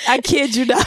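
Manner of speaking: ordinary delivery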